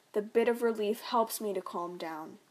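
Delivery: ordinary delivery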